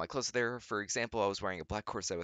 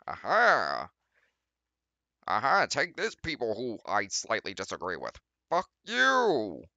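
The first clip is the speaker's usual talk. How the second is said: hammed-up defiance; 7 dB louder than usual. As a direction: yell it like a cartoon rebel. Hammed-up defiant fanfic reading.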